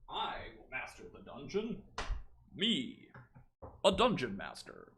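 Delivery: mock-grand put-on proclamation; goofy theatrical voice. Streamer goofing with his book, mock-grand theatrical voice. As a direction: ham it up with big swoops in pitch. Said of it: "I'm in a goofy mood today".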